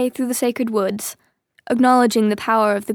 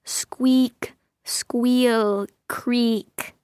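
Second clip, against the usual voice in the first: creaking gate; much slower than usual. A narrator's reading. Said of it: "the cat heard the gate warn him".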